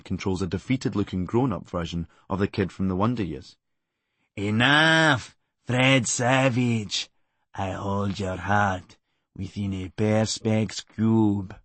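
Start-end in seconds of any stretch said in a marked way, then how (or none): 4.4-11.6 very slow, grand villain voiceover; booming psychic voiceover. Retold or imitated. Doctor Presley commanding a beaten man, slow booming voiceover. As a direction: intone it like an all-powerful mind controller. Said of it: "delivered as a booming psychic voiceover".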